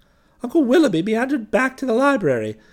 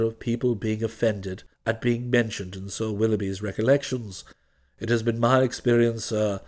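very low, measured deferential voice; unruffled valet; 13 semitones lower than usual. Acted. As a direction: explain with calm, formal dignity. Jeeves explains himself to Bertie.

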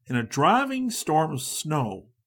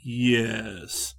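slowly; 2 semitones lower than usual. A narrator's reading. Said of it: "said Riley slowly".

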